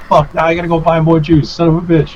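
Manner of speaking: mild replayed anger; retold frustration. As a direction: grumble it high, reliving the annoyance. Retold frustration at stolen juice.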